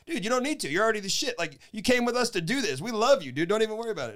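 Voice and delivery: cheering up a sad friend; reassuring friend. Retold or imitated. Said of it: "I was like".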